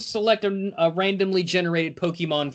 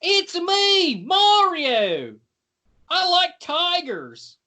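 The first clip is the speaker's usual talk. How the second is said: Mario impression; 10 semitones higher, much slower than usual. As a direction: shout it like a video game plumber. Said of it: "always end up sounding like Mario".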